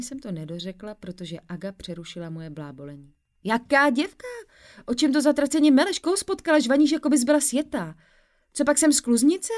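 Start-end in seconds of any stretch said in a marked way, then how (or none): 3.4-9.5 high, loud, rapid disbelief; a friend stunned and shrill on the phone; baffled outburst. Acted. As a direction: fire off confused questions. Friend interrupting, bewildered.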